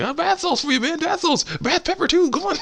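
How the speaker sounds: fast, very high falsetto sales pitch; see-through coaxing in a squeaky voice; coaxing walrus cook. Retold or imitated. Walrus luring Crash into the pot, coaxing falsetto. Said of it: "doing the same thing with this walrus".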